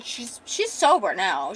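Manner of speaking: ordinary delivery, nothing marked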